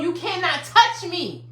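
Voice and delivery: ordinary talk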